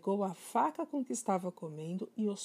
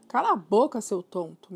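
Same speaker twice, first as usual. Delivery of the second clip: a loud snap at the parrot, dropping at the end; scolding; 2 semitones higher, 9 dB louder than usual. Acted. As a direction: tell the bird off sharply. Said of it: "Matilda repreendeu".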